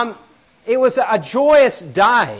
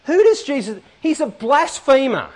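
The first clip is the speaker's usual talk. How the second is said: higher, pitched-up scoffing religious man; indignant skeptic; 6 semitones higher than usual. Acted. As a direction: act out a scandalised skeptic, voice raised in pitch. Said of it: "they were actually muttering to each other".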